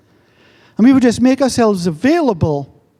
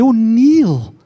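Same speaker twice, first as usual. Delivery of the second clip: admiring fan; 8 semitones higher than usual. Retold or imitated. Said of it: "People say to me".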